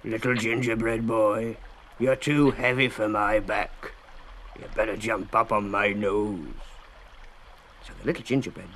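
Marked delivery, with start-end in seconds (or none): 0.0-6.5 slow, sly fox growl; growled offer. A narrator's reading. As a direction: tempt him with a slow growl.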